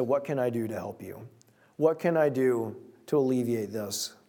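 gentle offer. Retold or imitated. Friend's compassionate offer retold.

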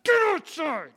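father snapping at Titus to get outside; exasperated shout. Retold or imitated. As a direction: bark the order at a stalling kid. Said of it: "I'm like".

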